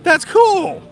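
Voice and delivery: high, loud, thrilled exclamation; gleeful, high-pitched "cool"; excited childhood delight. Retold or imitated. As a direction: burst out like an overjoyed kid. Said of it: "I got so excited and so happy".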